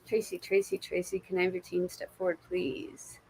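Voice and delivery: soft, fading call to the spirit guides; hushed invocation. Acted. Calls spirit team in reading.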